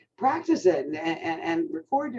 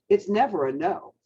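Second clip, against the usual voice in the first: normal talking voice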